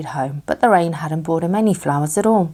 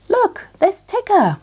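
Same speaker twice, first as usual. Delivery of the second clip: much higher, slow excited call; excited cry; 3 dB louder, slower than usual. A narrator's reading. Christopher Robin spotting Tigger, high excited cry. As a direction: point and shout with delight. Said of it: "Christopher Robin cried".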